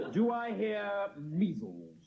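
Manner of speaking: very slow, drawn-out bidding call; stately auctioneer opening the bids; auctioneer's call. Acted. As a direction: stretch the words out to the room.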